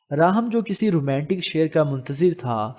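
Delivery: plain reading voice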